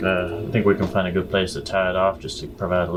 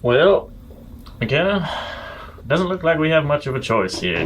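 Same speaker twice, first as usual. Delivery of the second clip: lightly raised adventurer voice, a touch higher; character voice; 7 semitones higher than usual. Acted. Companion to McKenna at the blocked path, lightly voiced higher character. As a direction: speak up as the party's worried companion.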